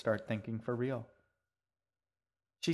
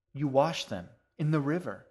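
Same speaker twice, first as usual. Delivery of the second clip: put-on louder, higher, triumphant girl; 5 semitones higher, 7 dB louder than usual. A narrator's reading. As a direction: announce it like a winning guess. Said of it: "she said triumphantly".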